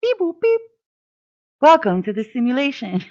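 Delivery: very high synthetic alien beeps. Pretend. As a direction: chirp it out like a tiny alien. Playful robot alien greeting.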